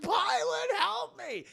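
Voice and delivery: very high mock shriek; panicked plea. Senator being kicked calls to his pilot, high mock panic. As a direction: squeal for rescue, hammed-up.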